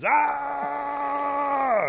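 long, high, loud storyteller's scream; pained scream. Acted. Rabbit pulled by a falling rock, screaming. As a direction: scream as if being stretched by the arms. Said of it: "Rabbit screams".

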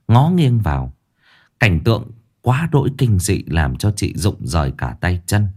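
usual speaking voice

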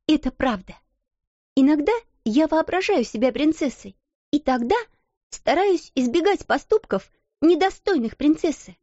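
high, steady proud declaration; proud, confident. A narrator's reading. Girl answering her mocking rival, proudly. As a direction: answer with calm pride. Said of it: "голос её звучал гордо и уверенно".

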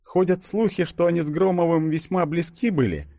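high female voice, casually dropping gossip; feigned casual aside. A narrator's reading. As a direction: drop it as if by chance, high voice. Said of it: "будто невзначай обронила Ёжик". (будто невзначай, высоким женским голосом)

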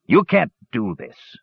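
understated roar of objection; roared protest. A narrator's reading. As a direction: protest indignantly, each word drawn out. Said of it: "roared the dwarf".

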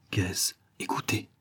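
soft, secretive near-whisper; very quiet, wary murmur; hushed. A narrator's reading. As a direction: keep it down while listening out. Listening for intruder in the dark.

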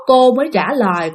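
usual speaking voice

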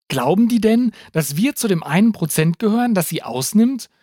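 hoch und laut, in Panik; panisch gerufen. A narrator's reading. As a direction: schreit es in wachsender Panik heraus. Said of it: "rief Takis, der immer panischer". (Takis ruft panisch gegen den anrückenden Mob, hoch und laut)

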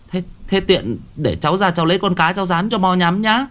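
a youth stumbling over his offer; stammering young man. Acted. Young man stammering an offer of fried fish to the shaman. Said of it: "cũng lắp bắp".

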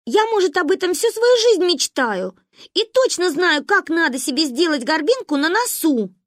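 put-on high, loud little-girl insistence; insistent. A narrator's reading. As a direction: argue passionately for your plan. Stubborn girl defends her plan.

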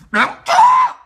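high, loud yelled insult; furious shriek. Real, not acted. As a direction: spit it out as a scream. Insult shouted at party.

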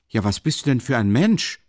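mildly alarmed workman; uneasy exclamation. A narrator's reading. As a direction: demand an answer with slight unease. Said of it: "rief der Arbeiter beunruhigt".

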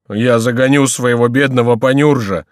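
loud, whining lament; plaintive wail in a character voice; tearful voice. A narrator's reading. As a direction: plead for pity, nearly crying. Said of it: "говорил Горанфло плачущим голосом".

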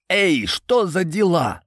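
slow, high-pitched muffled call. A narrator's reading. Jovan calls from where he is shut in, muffled voice. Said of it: "раздался глухой голос Йована".

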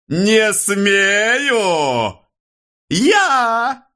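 very high, long outraged wail; drawn-out howl. A narrator's reading. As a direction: howl it out, stretching the vowels. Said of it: "протяжно взвыл Становой". (протяжный возмущённый вой)

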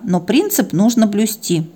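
plain reading voice

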